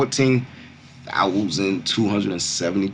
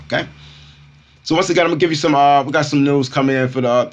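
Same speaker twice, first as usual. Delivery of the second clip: higher, louder news hype; crypto bro; 7 semitones higher, 6 dB louder, faster than usual. Real, not acted. Announcing crypto news to viewers.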